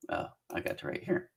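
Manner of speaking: under-the-breath unvoiced mutter, finding her place; muttered to self. Real, not acted. Muttering to herself while finding her place in the list, under her breath. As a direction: whisper it to yourself while scrolling.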